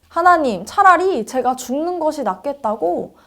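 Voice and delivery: narrated outcry, moderately heated; crying out. A narrator's reading. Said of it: "하나님께 소리쳤어요".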